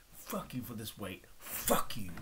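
low, bitter curse, the last words whispered. Real, not acted. Cursing God over his weight, bitter and low. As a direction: spit the curse out under the breath.

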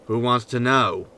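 ordinary talk

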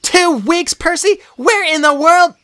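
put-on high, loud angry girl's yell; high, loud furious questions. A narrator's reading. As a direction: demand answers at full volume. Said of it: "she yelled".

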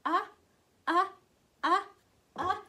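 repeated "uh" as a clipped short vowel sound; four short "uh" sounds, letter U. Shown as a demonstration. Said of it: "and it says".

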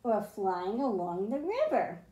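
put-on reading a picture book aloud to kids; storybook reading. A narrator's reading. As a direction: read it like a bedtime story for the class. Teacher reads picture book aloud.

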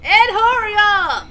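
shouting. Acted. Calling Ed in game.

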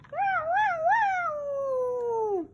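high siren wow; fire truck voice. Pretend. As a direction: wail it like a fire engine. Fire truck toy again.

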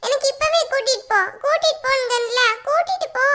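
put-on high-pitched little-kid pestering; insistent whiny child. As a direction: demand it like a child who won't give up. Child figure arguing and demanding.

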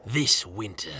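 deep movie trailer voice. Acted. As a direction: announce it like a trailer. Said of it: "Iron Man three trailer voiceover".

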